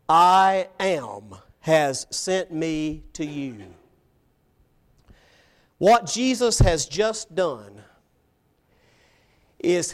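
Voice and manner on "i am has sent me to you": slow, stately, with "I am" held strong; solemn declaration. Acted. God telling Moses what to say to Israel, solemn. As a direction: pronounce it word by word with authority. God's message given to Moses.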